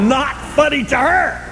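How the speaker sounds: high, slow mock indignation; mimicked indignant newcomer. New Al-Anon amid the laughing group, high mock disapproval.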